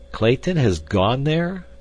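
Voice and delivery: slow, high, loud disbelieving question; chagrined exclamation. A narrator's reading. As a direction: draw it out, put out at the news. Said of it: "exclaimed Candler, visibly chagrined".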